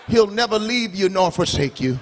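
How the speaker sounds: ordinary talk, nothing marked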